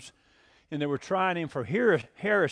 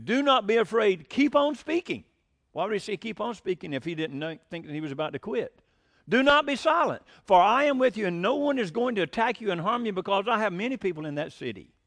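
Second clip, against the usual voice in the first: fiery preaching; 5 semitones higher than usual. Acted. Preacher voicing God telling Paul to keep speaking, booming. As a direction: proclaim it like thunder from the pulpit.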